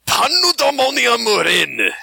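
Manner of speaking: put-on slow, high, raspy Japanese accent; drawn-out samurai movie voice. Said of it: "You have to say them with a Japanese accent".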